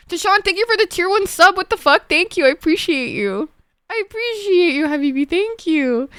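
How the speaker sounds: high, bright excited thank-yous to a subscriber. Real, not acted. Streamer thanks a new subscriber in a high excited voice. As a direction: gush over the new sub.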